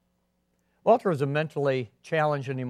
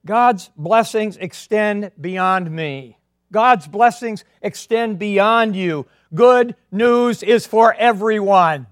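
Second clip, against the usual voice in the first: every word weighted, very slow and loud; slow emphatic proclamation; 4 semitones higher, 6 dB louder, much slower than usual. Real, not acted. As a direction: drive each word home from the pulpit.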